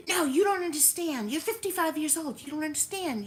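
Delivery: imitated furious son yelling; retold angry yell. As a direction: throw the teen's outburst back, loud and high. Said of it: "he'll scream at the top of his lungs".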